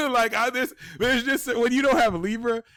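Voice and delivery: laughing. Real, not acted. Laughing at herself.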